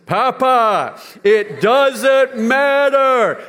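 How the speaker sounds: high, loud, very slow mock divine voice; child doing grandpa's God voice; granddaughter's God voice. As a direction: repeat it back as God would. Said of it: "you say it back to me in the God voice".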